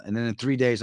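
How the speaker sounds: normal talking voice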